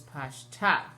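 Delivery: Pashta with the accent on TA; pash-TA, final syllable punched; stress on last syllable. Shown as a demonstration. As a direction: land firmly on the end of Pashta. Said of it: "have their accents at the end".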